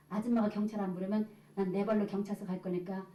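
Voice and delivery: ordinary delivery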